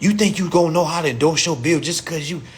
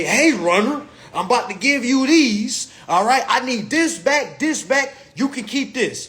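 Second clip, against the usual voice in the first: higher, louder bossy voice; plug giving orders; 8 semitones higher, 3 dB louder than usual. Retold or imitated. Plug handing goods to his runner, higher bossy voice. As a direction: instruct the runner like a street boss. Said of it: "he's gonna say".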